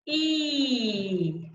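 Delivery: drawn-out, falling "E"; high-tongue vowel. Shown as a demonstration. Coach leading choir to try "E", long and pure. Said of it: "Try that".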